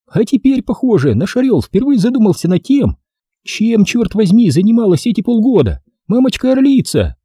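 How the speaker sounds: high-pitched, merry female voice; woman's amused mockery, pitched high; merrily teasing woman. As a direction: tease merrily in a high lady's voice. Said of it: "Весело смеялась она".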